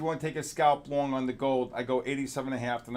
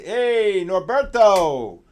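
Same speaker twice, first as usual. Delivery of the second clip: loud drawn-out call across the room; 6 semitones higher, 9 dB louder, much slower than usual. Real, not acted. Host calling out a trader's name on stream, loud and drawn out. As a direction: hail him loudly and stretch the name.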